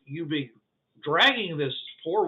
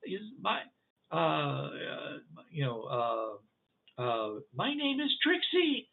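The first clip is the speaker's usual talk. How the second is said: put-on small child voice; 4 dB quieter, slower than usual. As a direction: introduce yourself like a shy small child. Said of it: "it's like a very small child".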